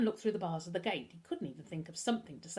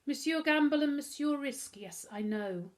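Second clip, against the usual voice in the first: lightly voiced French accent, starting high; 7 semitones higher, 4 dB louder than usual. A narrator's reading. Butler answers two visitors at the gate, French accent. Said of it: "replied in a French accent".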